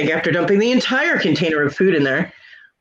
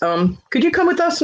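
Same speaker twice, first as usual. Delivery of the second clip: higher, sugary polite request; mock polite nurse; 10 semitones higher than usual. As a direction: put on a prim nurse voice. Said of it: "looked at me and goes".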